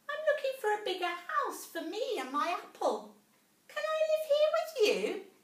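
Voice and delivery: a tiny mouse gasping in wonder; little mouse voice. A narrator's reading.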